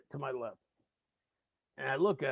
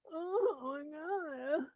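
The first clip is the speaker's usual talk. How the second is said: wasted rocker; 13 semitones higher, slower than usual. Retold or imitated. Wasted guy mid moon story, high drawn-out moan. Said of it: "blitzed out of his mind".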